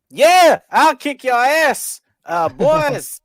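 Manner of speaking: loud, high, slow drawled bravado; Mark Briscoe voice. Retold or imitated. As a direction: yell it like a cocky brawler.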